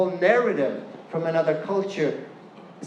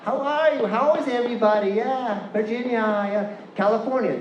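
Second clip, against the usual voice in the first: Californian accent; 6 semitones higher than usual. Shown as a demonstration. Speaker showing an audience their "ah", Californian accent. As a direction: lay on the wide Californian "ah". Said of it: "You can hear from my ah".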